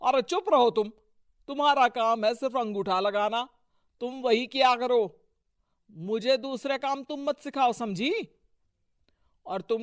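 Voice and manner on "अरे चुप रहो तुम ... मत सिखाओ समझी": raised, domineering rebuke; angry scolding. Acted. Husband silencing his protesting wife, angry. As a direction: put her sharply in her place.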